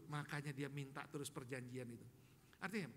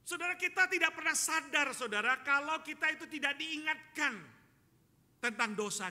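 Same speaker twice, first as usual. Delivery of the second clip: loud, high admonishing call; forceful preaching; 9 semitones higher, 12 dB louder than usual. Real, not acted. Preacher warning congregation about sin, forceful and loud. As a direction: drive the warning home at full voice.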